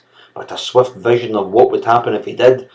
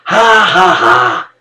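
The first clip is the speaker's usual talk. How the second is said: high, loud mocking ha ha; blatant laugh; 7 semitones higher, 4 dB louder, slower than usual. A narrator's reading. Servant laughing scornfully at the mistress. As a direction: laugh in her face. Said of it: "burst into a blatant laugh".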